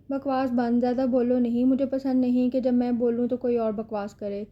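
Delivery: ordinary delivery, nothing marked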